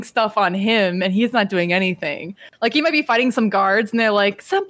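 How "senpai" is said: high, adoring squeal; fawning senpai. Retold or imitated. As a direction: gush the word like a fan. Guards fawning over Buggy.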